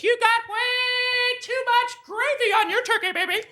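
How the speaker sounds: very high sing-song drawled scolding; sassy mom. Retold or imitated. Mother scolding cocky son, high sing-song sass. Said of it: "She goes".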